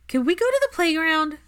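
put-on high, loud, hopeful child's question; a kid begging to go play; pleading little boy. Albus asking the grown-ups for the playground, high eager child voice.

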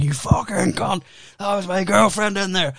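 high, strained outraged rant; angry yell. Retold or imitated. Drunk comic screaming at bouncers, high outraged yell.

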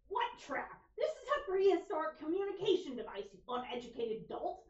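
sassy high-pitched loud robot; haughty, sneering Starscream impression. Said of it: "Starscream responds with".

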